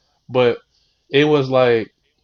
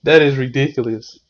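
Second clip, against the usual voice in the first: ordinary talk, nothing marked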